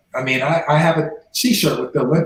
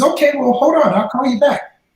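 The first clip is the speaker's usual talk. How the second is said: lightly mimicked woman caller, higher; hurried sign-off, retold in a higher voice; friend on phone; 9 semitones higher, 4 dB louder than usual. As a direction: imitate her pitched well up. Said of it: "She says".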